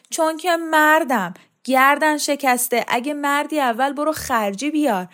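high, loud quoted bluster; wife mimicking her bullying husband's anger; angry husband.

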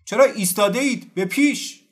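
officer yelling at his troops; high, loud military command; shouted order. A narrator's reading. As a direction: drive the men forward with a shout. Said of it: "بر سر قزاقان داد زد".

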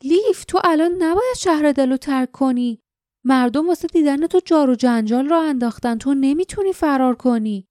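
high-pitched, dismayed pleading; astonished objection to a friend; stunned protest. A narrator's reading. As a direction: protest in disbelief, pitch swooping up. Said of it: "مات و مبهوت به او خیره شد".

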